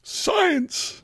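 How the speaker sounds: high exclaimed Science; a hammed-up cry of wonder; mock excited. Co-host hams up a cry of wonder at the tech.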